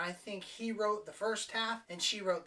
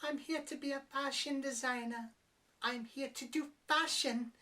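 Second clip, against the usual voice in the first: higher, meek mock-girl voice; mopey pushover; 7 semitones higher than usual. Reviewer mocking the lead's meek way of talking. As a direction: mimic a timid heroine. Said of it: "that's how she talks".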